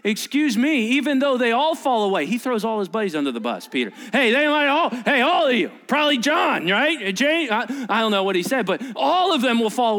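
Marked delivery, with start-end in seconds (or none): 0.0-2.3 higher, louder indignant objection; miffed, raised-voice protest; a little upset. Retold or imitated. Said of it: "gets a little upset and he says". 4.1-7.5 loud, high call-out; brash, raised-voice naming of names; acted finger-pointing. Peter loudly singling out his friends.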